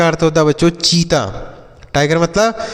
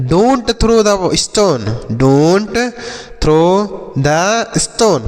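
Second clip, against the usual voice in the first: very slow, every word stretched; slow model sentence; 2 semitones higher, much slower than usual. Shown as a demonstration. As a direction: say it slowly so pupils can copy. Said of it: "तो आप बोल सकते हैं". (धीरे-धीरे, शब्द खींचकर)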